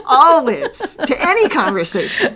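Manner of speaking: high, loud burst through laughter; laughing compliment. Real, not acted. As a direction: gush it while laughing. Effusive compliment while both laugh.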